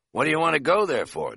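pushy question from a gruff cab dispatcher; lightly voiced suspicious local; demanding. A narrator's reading. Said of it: "demanded the voice".

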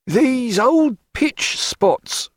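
very slow, high coaxing sailor; high, slow wheedling seaman. A narrator's reading. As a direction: wheedle him off the deck. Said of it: "in a wheedling, reasoning voice".

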